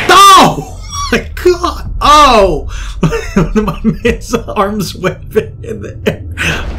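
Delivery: high outcry breaking into laughter; high, helpless laughing speech; laughing hard. Real, not acted. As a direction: crack up while talking. Cracks up at the crash.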